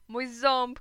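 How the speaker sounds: put-on loud, high child's wail; upset cry. A narrator's reading. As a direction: cry out in alarm over a lost tooth. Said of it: "kliknąłem". (płaczliwy, głośny okrzyk)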